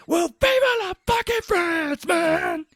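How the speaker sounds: high, loud, slow drawn-out shout; hammed-up bizarre cheer; mock Cage yell. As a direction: draw it out like a deranged toast. Cage's absurd slow outburst.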